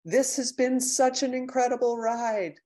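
high, loud, gushing declaration; a high, bright burst of love; heartfelt call to a friend. Retold or imitated. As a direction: pour it out to her like it's your last chance. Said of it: "and just say".

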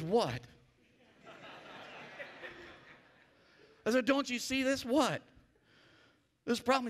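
full-force preaching. Acted. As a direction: preach it out to the room.

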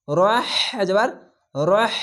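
রহ with a breathy throat হ, slow; breathy ha demo. Shown as a demonstration. Teacher shows students how হা should sound, breathy. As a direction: push air out on the হ of রহ. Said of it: "এই সাউন্ডটি বের হবে".

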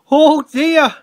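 high, loud cry of dismay; alarmed exclamation. A narrator's reading. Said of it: "exclaimed Thomas".

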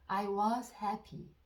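very slow, each word spaced out for repeating; dictation-slow teacher read; slow model sentence. Shown as a demonstration. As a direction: say it slowly so learners can follow. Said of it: "듣고 따라해 보세요".

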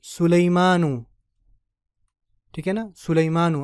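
सुलेमानू said slowly with a long final ू; teaching demo. Shown as a demonstration. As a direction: land on the "नू" ending. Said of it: "जैसे मैंने कहा था यूसुफू". (सुलेमानू, आख़िरी "नू" लंबा)

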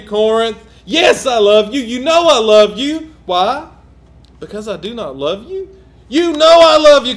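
loud, rising pulpit cadence, repeating Corinth; preaching at full force. Acted. Preacher voicing Paul's love to Corinth, full force. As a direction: preach it hard, building with each line.

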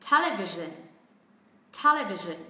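TEL-evision, TEL loud, the rest dropping and short; first-syllable stress. Shown as a demonstration. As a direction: punch TEL in Television, then let the pitch fall. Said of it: "xuống giọng ở những âm tiết còn lại".

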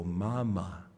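put-on "Mama" in two drawn-out, separate syllables, as to a baby; coaxing "Mama", each syllable clearly mouthed; mouthing for baby. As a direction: model "Mama" slowly for an infant to copy. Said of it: "Then you mouth the words".

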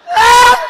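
sudden high yelled Ah; piercing imitated scream; mock holler. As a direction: belt out a wild holler like the crazy brother. Brother hollering again.

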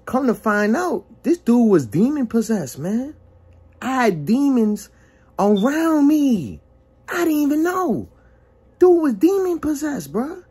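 shocked revelation. Real, not acted. Telling viewers his friend was demon possessed, astonished.

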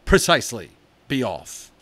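put-on cross-examine the boy sternly; father scolding his son about the greenhouse; angry father. A narrator's reading. Father dismisses him.

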